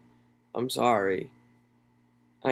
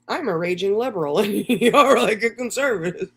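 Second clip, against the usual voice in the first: high-pitched reading broken by laughter; laughing mock quote; 7 semitones higher, 4 dB louder than usual. Streamer reading out another woman's text to viewers, laughing mockingly. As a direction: crack up while quoting someone's text.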